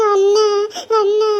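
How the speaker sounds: tearful, pleading call to brother. Acted. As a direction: call out to your brother while crying. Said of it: "அழுகக்கூடாதுடா செல்லம்". (அழுகையுடன் அண்ணனை அழைத்தல்)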